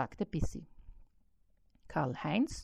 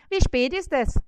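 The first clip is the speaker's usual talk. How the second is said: high, loud fly's call; 9 semitones higher, 4 dB louder than usual. A narrator's reading. Fly Bissi calls the spider, high and loud. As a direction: shout up to the spider, shrill and small.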